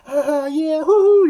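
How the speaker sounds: high, loud whooping cheer from the next room; whooping partygoers. Retold or imitated. Storyteller imitating coworkers partying next door, whooping loud and high. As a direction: mimic the fun room cheering wildly.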